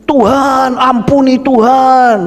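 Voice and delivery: high, drawn-out cry for mercy; desperate plea. Acted. A damned soul begs God for mercy, desperate plea.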